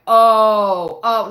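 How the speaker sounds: loud drawn-out shocked oh; shocked gasp. Retold or imitated. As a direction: react like you can't believe what you heard. Friend reacts to rude server.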